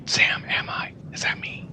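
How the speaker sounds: unvoiced whispered aside. Real, not acted. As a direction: whisper the question off-mic.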